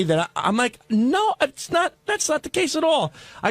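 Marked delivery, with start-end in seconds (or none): 0.9-3.1 retold protest. [name] replaying his answer to Robin's accusation, protesting. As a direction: plead your innocence in a raised pitch.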